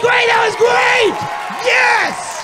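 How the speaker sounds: high, loud, slow excited praise; excited cheering. Real, not acted. Comic praising the audience member who guessed, high loud cheer. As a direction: cheer her on at the top of your voice.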